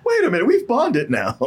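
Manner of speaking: squeaky, hammed-up protest; sudden second thoughts. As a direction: play suddenly attached, pitched comically high. Acting escort suddenly attached to child.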